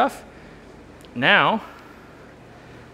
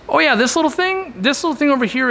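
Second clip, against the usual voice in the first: excited; 10 semitones higher than usual. Real, not acted. Showing off huge new aquarium.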